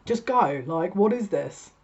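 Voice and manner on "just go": louder, fed-up urging; exasperated. Real, not acted. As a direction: shove them along, exasperated. Exasperated at the sitter.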